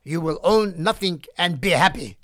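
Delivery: thick German accent, a bit clumsily put on; mock a German executive in an amateur German accent; a self-admittedly weak German accent impression. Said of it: "in his thick German accent".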